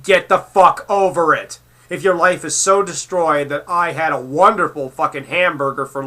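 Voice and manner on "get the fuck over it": ordinary talk, nothing marked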